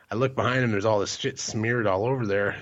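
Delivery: normal talking voice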